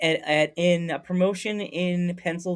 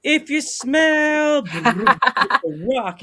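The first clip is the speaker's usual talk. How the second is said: high, loud, drawn-out wrestling promo call; The Rock catchphrase; 9 semitones higher, 5 dB louder, much slower than usual. Retold or imitated. Friend hears the Rock on TV, slow booming promo voice. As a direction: belt out the catchphrase like a wrestler on the mic. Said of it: "And all of a sudden you hear".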